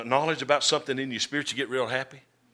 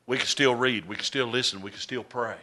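each still stressed, getting softer; repeated still, quieter each time; stress on still, fading; 4 semitones lower, 7 dB quieter than usual. Real, not acted. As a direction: press STILL each time, then let it fall away. Preacher builds a list, fading.

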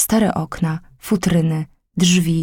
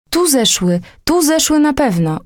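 townsfolk calling; 8 semitones higher than usual. Acted. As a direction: shout down the cellar stairs. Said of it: "ozwały się głosy na górze".

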